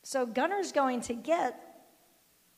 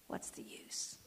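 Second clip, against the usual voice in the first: breathy sigh into a hopeless whisper; despairing whisper; 12 dB quieter, slower than usual. Acted. Preacher voicing despair, whispered. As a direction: give up under your breath.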